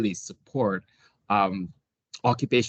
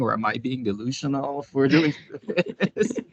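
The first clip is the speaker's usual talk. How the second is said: breaking into real laughter mid-sentence; laughing self-doubt; 4 semitones higher than usual. Real, not acted. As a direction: laugh through it, voice climbing higher.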